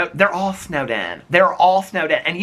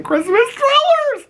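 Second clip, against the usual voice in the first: very high, slow squeal of delight; squeaky high; 4 dB louder, slower than usual. Acted. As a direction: squeal it out in a tiny voice. Cozy scene punchline.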